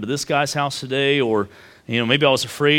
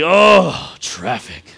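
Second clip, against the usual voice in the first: annoyed groan; 3 semitones higher, 7 dB louder, much slower than usual. Retold or imitated. Dad groaning at traffic while driving his sons. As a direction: groan at the traffic like a fed-up driver. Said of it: "and I would say".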